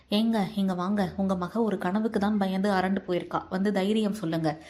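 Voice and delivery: ordinary delivery, nothing marked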